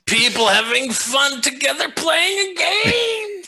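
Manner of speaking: high, slow outraged shout; mock horrified shout. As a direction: shout it in fake horror. Mock horror at fun.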